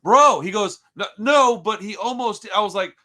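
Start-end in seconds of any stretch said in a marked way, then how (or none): 1.0-1.6 high, loud "no, no" from the cop; mock cop, loud and high; cop says no. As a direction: retell the cop cutting him off. Cop answering him.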